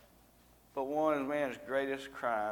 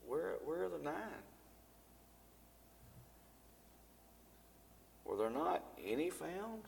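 softer, sorrowful questioning; sad, disappointed; 5 semitones higher, 8 dB quieter than usual. Shown as a demonstration. Pastor voicing Jesus' sorrowful question to the congregation. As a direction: ask with quiet hurt and disappointment. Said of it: "Maybe he said it in that tone".

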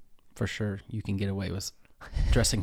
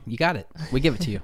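loud giveaway; 5 semitones higher, 6 dB louder, faster than usual. Pretend. Oprah-style giveaway shout.

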